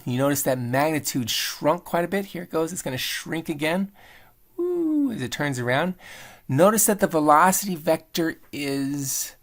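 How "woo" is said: high, sustained "woo"; a long high-pitched woo, like a swoop; playful woo. Real, not acted. Follows object as it turns.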